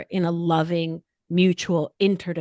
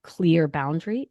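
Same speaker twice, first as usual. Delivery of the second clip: ordinary delivery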